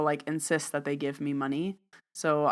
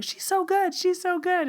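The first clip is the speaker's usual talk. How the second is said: mock yelling. Other guy in the back praising the reader, mock yell. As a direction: imitate a yell from the back in a high voice. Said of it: "sit in the back yelling".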